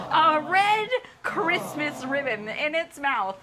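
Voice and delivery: ordinary talk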